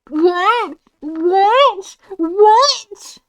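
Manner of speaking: high, loud repeated what; escalating stunned questions; disbelief. Real, not acted. Player reacting to a shocking line, loud disbelief.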